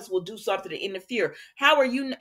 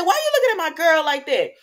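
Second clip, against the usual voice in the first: louder, higher and fast challenge; heated mock confrontation; 7 semitones higher, 7 dB louder, faster than usual. Vlogger replaying her challenge, higher, louder, fast. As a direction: fire it at him like a jealous rival. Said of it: "so I said".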